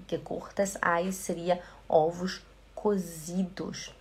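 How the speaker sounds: ordinary delivery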